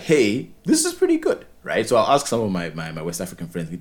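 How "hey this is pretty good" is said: high, bright mock exclamation; excited reaction. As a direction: blurt it out high and thrilled. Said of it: "I'm like".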